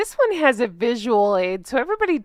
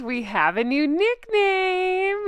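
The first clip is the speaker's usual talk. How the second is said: excited tease; 7 semitones higher, slower than usual. Real, not acted. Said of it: "You guys".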